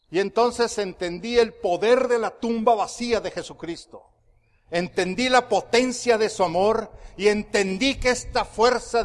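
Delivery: sermón fuerte y enfático; prédica a toda voz. Real, not acted. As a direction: proclamar con fuerza ante la congregación. Preaches at full force. (predicación enérgica, a pleno pulmón)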